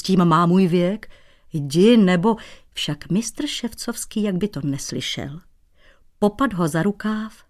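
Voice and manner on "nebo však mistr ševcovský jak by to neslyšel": ordinary delivery, nothing marked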